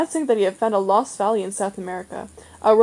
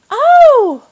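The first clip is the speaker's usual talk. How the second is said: much higher and louder, delighted cry; 15 dB louder than usual. Acted. Boy knocked over by the dog, crying out with delight. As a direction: squeal with delight. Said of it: "Barney said in delight".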